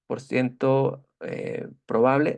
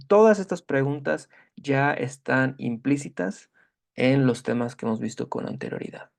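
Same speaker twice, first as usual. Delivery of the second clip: ordinary talk, nothing marked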